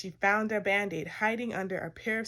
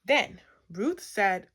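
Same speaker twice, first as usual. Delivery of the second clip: ordinary delivery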